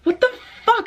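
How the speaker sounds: stunned relief turning to outrage; incredulous. Retold or imitated. Learning the knocker is Adam, high and incredulous. Relief, neighbour turns out harmless.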